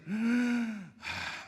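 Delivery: long sighing exhale after drawing breath in; the four letters pronounced as breath; breathing the name. Shown as a demonstration. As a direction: breathe in, then let the name out as a sigh. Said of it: "respire para adentro".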